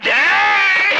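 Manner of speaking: high, loud, drawn-out yell; furious shout. Acted. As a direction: explode at him in exasperation. Exasperated after the banana answer. (கோபத்தில் உச்சக் குரலில் கத்தல்)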